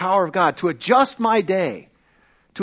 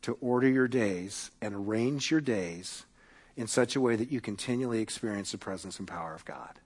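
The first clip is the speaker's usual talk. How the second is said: softer, lower, calm and steady; 6 semitones lower, 9 dB quieter than usual. Retold or imitated. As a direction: gently settle an anxious pastor. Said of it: "Dallas very calmly says".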